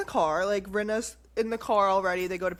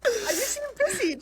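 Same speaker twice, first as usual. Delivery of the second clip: high, laughing through the quote; laughing mimic; 13 semitones higher than usual. Host quoting Rinna while laughing, high. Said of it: "She's literally like".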